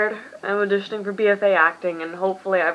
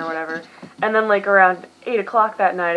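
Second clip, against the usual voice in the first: ordinary delivery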